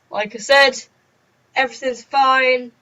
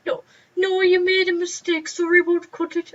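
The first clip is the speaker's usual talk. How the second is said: put-on old man teacher; 9 semitones higher than usual. Old teacher scolding a running pupil, a high creaky voice. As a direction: quaver it like an elderly teacher.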